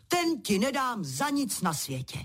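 emphatic refusal without real shouting; crying out. A narrator's reading. King will never part with his sword, crying out. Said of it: "vykřikl král".

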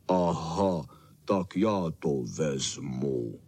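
low, slow dog voice; Bernardín, slow big dog. Acted. Bernardín offers to take the vase, low and slow. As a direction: rumble it low and unhurried.

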